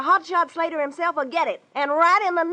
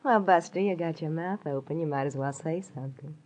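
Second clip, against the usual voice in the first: much lower and softer, teasing; 9 semitones lower, 6 dB quieter than usual. Acted. Tough girl teasing the gawking detective, low and quiet. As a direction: let it sink low and lazy. Angie sizing up Marlowe coolly.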